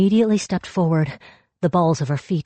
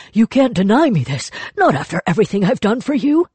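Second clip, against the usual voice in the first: high, loud resentful insistence; bitter demand; 6 semitones higher, 4 dB louder, faster than usual. Acted. Tortured spirit rounds on Yang Chen, bitter and loud. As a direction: claim what you're owed.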